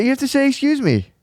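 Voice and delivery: very high-pitched child impression; manners-obsessed little kid. Little kid correcting a parent's manners, mimicked child voice. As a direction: mimic a tiny stickler for manners. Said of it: "when little kids get, like, real obsessed with manners".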